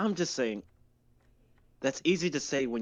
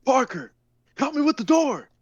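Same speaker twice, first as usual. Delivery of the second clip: much higher, loud cry for help; panicked shout; 14 semitones higher, 10 dB louder than usual. Acted. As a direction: scream it over the chaos. Damien calls for help while fleeing.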